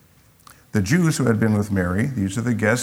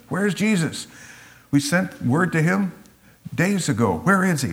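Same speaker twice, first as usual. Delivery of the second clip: higher, worried questions; anxious asking; 7 semitones higher than usual. Pretend. Sisters worrying to each other where Jesus is.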